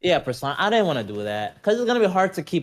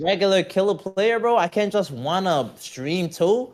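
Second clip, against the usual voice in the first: mock-indignant, higher-pitched plea; protesting retort; 7 semitones higher, 3 dB louder than usual. Streamer answering someone who laughed at him streaming, indignant. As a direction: plead the case with playful grievance. Acted retort to a mocking comment.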